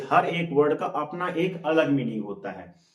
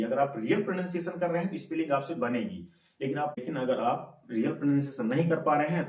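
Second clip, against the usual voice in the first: ordinary delivery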